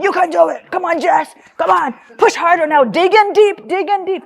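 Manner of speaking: high, strained urging in someone's ear; pushy coaching dad. Retold or imitated. Dad urging crying daughter up the hill, high intense coaching. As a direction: drive them uphill with mock drill-coach intensity. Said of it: "he's in my ear going".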